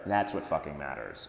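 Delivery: ordinary talk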